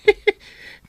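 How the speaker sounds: a high, loud burst of laughter. Acted. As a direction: let a laugh explode out. (napigsa nga katawa)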